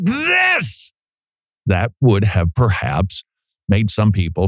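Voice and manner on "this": shout. Shown as a demonstration. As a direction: blast the one word to show heat. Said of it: "I didn't say it like".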